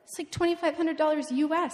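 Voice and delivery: usual speaking voice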